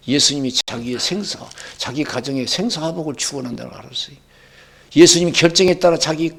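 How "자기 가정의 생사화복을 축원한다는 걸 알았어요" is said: usual speaking voice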